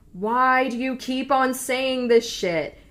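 loud-ish, drawn-out mock exasperation; exasperated. Retold friend is fed up.

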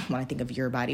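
can't keep a straight face on the retake; laughing. Real, not acted.

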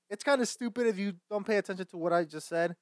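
usual speaking voice

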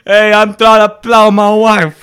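very loud, high put-on yell; mock horny driver shout. Friend voices the rushing driver, very loud high mock yell. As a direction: bellow like a cartoon brute.

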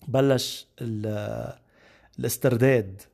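ordinary delivery

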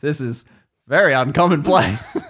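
laughing through the words; real laughter. Real, not acted. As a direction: giggle while admitting you're out of your depth. Laughs at host's expertise.

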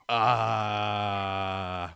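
low, drawn-out droning "uh"; acted-out stuck groan; long stalling "uh". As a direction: hold one low note of dumbfounded hesitation. Acts being stuck, nowhere to go.

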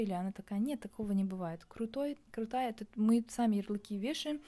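ordinary delivery

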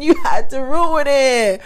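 mock scolding. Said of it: "girl, we just started".

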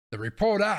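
"reporter" with a dropped r, "repawtah"; Boston accent. Retold or imitated. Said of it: "busted out your Boston accent".

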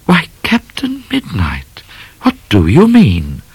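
high, slow coy astonishment; theatrical lady. Acted. Miss Laura questioning her captor, theatrical lady. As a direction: ask it slowly, swooping up high.

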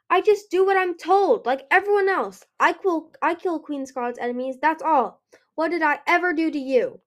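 high, loud protest, easing a little; defensive outburst. A narrator's reading. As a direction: plead your case indignantly. Accused Peril defends herself hotly.

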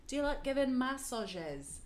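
drawn-out mock Manchester accent; imperfect Manchester accent. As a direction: badly imitate a man from Manchester. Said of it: "Maybe this isn't a perfect accent".

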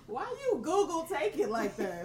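ordinary talk, nothing marked

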